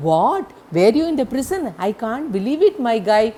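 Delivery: lady startled to learn the helper was in prison, loud and high; shocked lady. Retold or imitated. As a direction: gasp it out as if you can't believe your ears. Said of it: "lady reacts to prison news".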